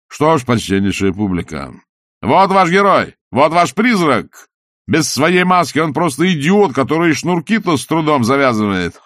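high, loud showman announcing; booming circus ringmaster. Acted. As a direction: present him like a circus freak. Salzella presents Walter to audience.

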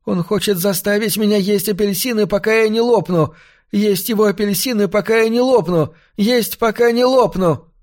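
put-on high-pitched, panicked boy's voice; frightened child's wail, pitched well up; child's nightmare cry. A narrator's reading. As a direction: cry out like a boy waking from a nightmare. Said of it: "мать мальчика услышала его крик".

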